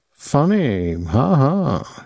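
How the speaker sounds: mildly cold mock laugh. A narrator's reading. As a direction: say the laugh coldly, without amusement. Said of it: "he said coldly".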